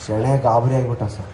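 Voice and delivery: normal talking voice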